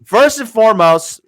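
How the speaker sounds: ordinary talk, nothing marked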